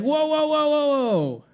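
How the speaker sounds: high, loud acted string of whoas; alarmed protest. Husband stopping wife from clearing his closet, loud alarmed protest. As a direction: cry out to halt someone, drawing out the last one. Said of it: "we get angry, and we gotta get defensive".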